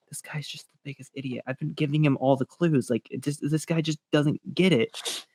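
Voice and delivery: quiet muttered scorn; muttering to himself. Retold or imitated. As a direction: mutter it under your breath, fed up. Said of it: "Sidious is just like".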